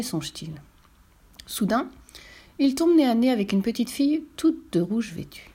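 plain reading voice

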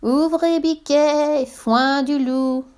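high, very slow, stretched-out falsetto; wolf faking a tiny sweet voice; tiniest voice. A narrator's reading. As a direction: coax in a teeny high voice. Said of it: "en faisant sa plus petite voix".

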